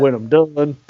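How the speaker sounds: lightly imitated Texas accent; mock Texas accent, as if about to spit. Host replaying the ballplayer's brush-off, mock Texas accent. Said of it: "in that big Texas accent that he has".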